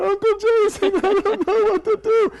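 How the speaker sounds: a sobbing niece with a broken doll; upset small child. Retold or imitated. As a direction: cry it out like a little kid with a broken doll.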